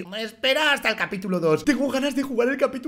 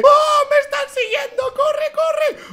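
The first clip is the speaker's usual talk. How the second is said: being chased; 13 semitones higher, 6 dB louder than usual. Real, not acted. Chased by Skibidi police in game.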